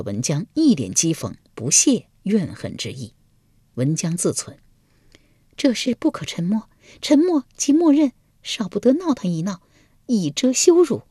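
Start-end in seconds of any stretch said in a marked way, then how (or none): 5.6-11.0 plotting to herself; Wenjiang's inner thought. A narrator's reading. (文姜心里盘算)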